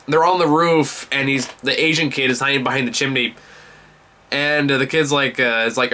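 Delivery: ordinary talk, nothing marked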